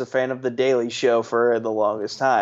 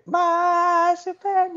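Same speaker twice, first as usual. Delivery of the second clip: mock Superman cry; slower than usual. Mock dramatic declaration.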